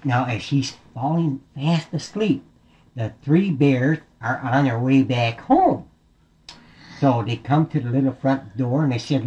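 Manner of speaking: ordinary delivery, nothing marked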